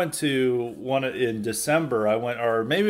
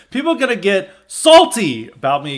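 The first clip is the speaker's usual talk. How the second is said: loud emphatic quote; mock-indignant quoted line; quoting a post; 6 semitones higher, 9 dB louder than usual. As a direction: read it out with a punch on salty. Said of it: "He said".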